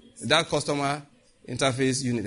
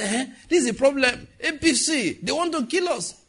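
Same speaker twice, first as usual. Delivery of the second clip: much higher mock panic; panicked complainer; 10 semitones higher than usual. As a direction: fret that they'll be killed.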